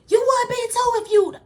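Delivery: outburst. Retold or imitated. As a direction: blurt it out high and loud like a snapped comeback. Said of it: "And I was like".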